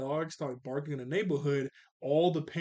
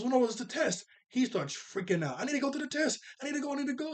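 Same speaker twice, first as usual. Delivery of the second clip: put-on high, frantic kid voice; mock panic, pitched way up; panicked kid; 10 semitones higher, faster than usual. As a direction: freak out about missing the test. Tommy freaking out voiced.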